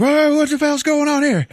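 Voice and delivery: very high, loud bewildered whoa; startled waking cry. Retold or imitated. Martin wakes from a head shot, very high, loud and startled. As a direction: wake up shocked and yell it. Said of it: "he's like".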